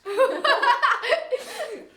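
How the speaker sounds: said through laughter; giggling the line; laughing. Retold or imitated. Quoting while laughing.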